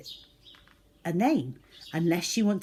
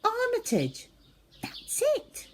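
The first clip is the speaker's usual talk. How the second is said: very high, excited cry; exclaimed. Acted. Zoe names the rat, bursting with excitement. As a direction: leap up in delight at the discovery. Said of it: "she exclaimed".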